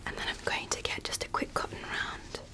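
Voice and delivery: ordinary delivery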